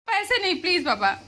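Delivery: high, loud insistent pleading; pleading refusal. Real, not acted. Daughter refuses money from father, high loud pleading. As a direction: beg him to keep his money.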